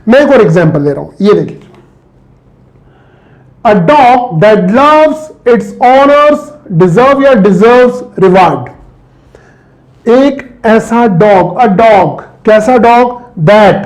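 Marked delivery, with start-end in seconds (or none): 3.6-8.7 slow reading. Shown as a demonstration. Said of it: "reads the exercise sentence slowly".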